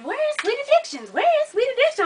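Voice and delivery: high-pitched mimicked clamoring; impatient followers. Waiting people asking after her online, high impatient mimicry.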